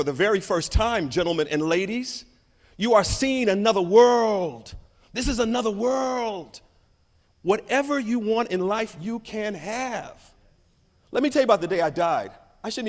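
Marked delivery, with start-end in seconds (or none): 2.8-10.2 slow, inspiring address; slow it down and inspire; slow uplifting. Acted.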